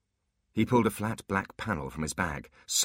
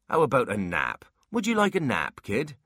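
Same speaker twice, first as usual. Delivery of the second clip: louder, lifting to a high pitch; falsely bright; 7 semitones higher, 6 dB louder than usual. A narrator's reading. Dwarf suggesting a nap to the rude kid, brightly. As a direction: offer it with loud, bright cheer. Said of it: "said Mulch brightly".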